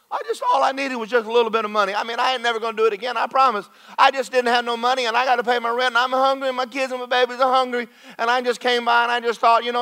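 high, loud, fast whining excuses; whining suspect. Pretend. Preacher playing a thief in a police car, high whine. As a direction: plead your innocence from the back seat, high and fast. Voices the arrested man.

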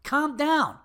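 high-pitched, indignant order; fed-up customer snapping; exasperated command. Acted. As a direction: tell him off in two words. Exasperated order to rushing worker.

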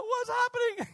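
high-pitched alarmed cry; panicked sailor. Acted. Voicing panicked sailors in the storm.

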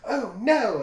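high, loud mock "oh no" shriek; mock panic. Recapping a TV scene, imitating cornered characters' mock panic. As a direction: squeal it like a cornered victim.

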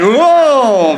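loud, high excited whoa; kids' whoa. Retold or imitated. Children reacting to a crowd surf threat, mock excited whoa. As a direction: gasp it like thrilled kids. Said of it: "And they went".

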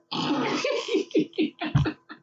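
high, airless laugh; breathless laugh. Real, not acted. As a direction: wheeze out a laugh. Laughs at blanket joke.

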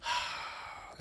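breathy sigh after a rant; winding-down sigh; long sigh. Real, not acted. Sighs after the comic rant.

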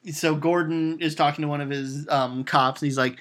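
ordinary talk

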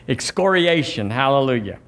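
ordinary talk, nothing marked

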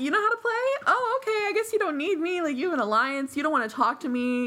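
high, mock-hurt sarcasm; sarcastic, annoyed. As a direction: throw it back sarcastically. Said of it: "She's so pissed".

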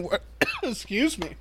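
coughing, choking on iced tea; sputtering through a cough; choking on a drink. Real, not acted. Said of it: "I'm choking on my iced tea".

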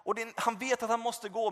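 normal talking voice

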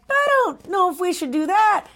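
high-pitched mock reluctance; imitating a wary girl; hesitant objection. Retold hesitant character.

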